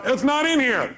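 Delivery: mock exasperation; exasperated searcher. Acting out failed search for salt.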